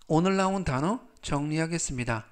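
usual speaking voice

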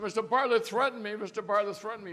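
high, loud mock whine to the judge; whiny tattling deputy. Deputy whining to the judge about the threat, high mock voice. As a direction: tattle like a hurt kid.

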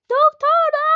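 high, loud shout for the doctor; high loud call for a doctor; urgent shout. Pretend. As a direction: yell it out in alarm.

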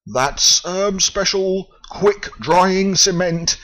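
high, slow wail. Acted. The foreman despairs over his cement, high slow wail. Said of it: "he wailed".

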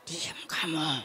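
low, soft hissed warning; quiet threat. Pretend. Mother quietly scolding crying child while pinching, low and soft. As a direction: hush the child under your breath. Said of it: "Suaranya pelan".

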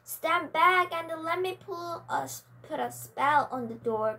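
lightly voiced witch giving a command. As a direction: order everyone back with authority. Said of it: "commanded the wicked witch".

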